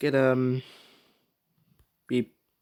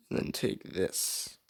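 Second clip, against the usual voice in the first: ordinary talk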